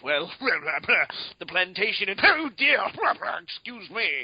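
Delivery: mock genteel gentleman, flustered mid-sentence. Prim gent at the park, fluster over bleeding gums. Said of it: "when you're like".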